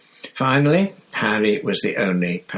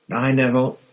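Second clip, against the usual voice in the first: called farewell; 5 semitones higher than usual. Acted. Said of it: "called Stan".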